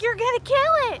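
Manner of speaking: put-on high, loud desperate kid; pleading yell. Child begging dad to spare the snake, loud high pleading. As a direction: beg him loudly not to hurt it. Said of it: "I'm like".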